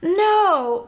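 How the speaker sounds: an irritated no, like losing the ball; annoyed no. Retold or imitated. Finn reacting to Chewie exploding, mildly annoyed. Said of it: "not the right kind of no".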